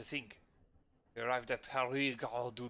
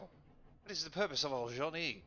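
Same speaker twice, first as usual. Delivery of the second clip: hammed-up French accent, first line higher and louder; ridiculous French accent; 3 semitones higher, 3 dB louder than usual. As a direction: play it as an over-the-top Frenchman. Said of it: "the ridiculous French accent for Pamatois".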